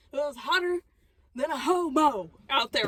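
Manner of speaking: normal talking voice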